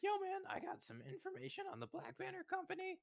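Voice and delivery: high-pitched sly informant passing on gossip; informant NPC voice. Retold or imitated. Player voicing the informant who tips him off, in a high sly voice. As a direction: pitch it way up like a street snitch.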